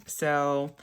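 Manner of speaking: normal talking voice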